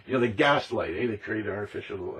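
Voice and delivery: ordinary talk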